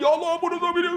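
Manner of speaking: sudden high-pitched mock wail; imitated bawling prayer. Pilgrim praying for his wife on prompting, mock wailing. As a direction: burst out high, hammed up and comic. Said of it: "Dia teriak-teriak".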